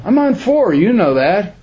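high, defensive protest of a newcomer; defensive whine. Retold or imitated. Newcomer answering his sponsor, high and defensive. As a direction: whine it like he should already know. Retelling sponsor asking what step.

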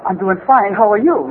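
copying a little Japanese-bar regular; mimicking the little guy. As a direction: answer in the little fellow's voice. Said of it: "whoever I'm around, I start acting like".

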